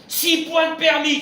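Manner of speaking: loud, high mock indignation; exclaimed penalty. Rabbi mocking lost licence points, loud high indignant cry.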